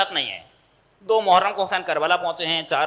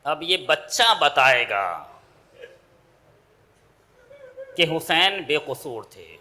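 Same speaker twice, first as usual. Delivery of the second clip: mournful lament; 3 semitones lower, slower than usual. Acted. Masaib lament section.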